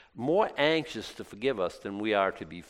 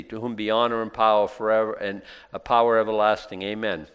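scripture reading; 2 semitones lower, 5 dB louder than usual. Acted. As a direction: read the doxology with reverence. Reads scripture doxology.

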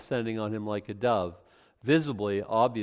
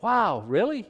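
mock surprise; 9 semitones higher, 4 dB louder, slower than usual. Jesus surprised at baptism news.

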